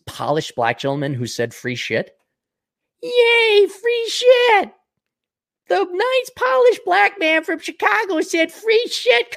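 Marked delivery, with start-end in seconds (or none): 3.0-4.7 very high squeaky mocking cheer; sarcastic falsetto yay. 5.7-9.4 very high squeaky mocking cheer; sarcastic falsetto yay. Mocking voice continues.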